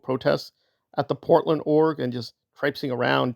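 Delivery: ordinary talk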